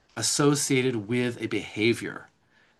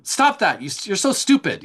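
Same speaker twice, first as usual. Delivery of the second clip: much higher, louder contemptuous scolding; contemptuous yell; 9 semitones higher, 6 dB louder than usual. Retold or imitated. Toxic parent berating a child, high and loud with contempt. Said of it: "there could be contempt in the tone".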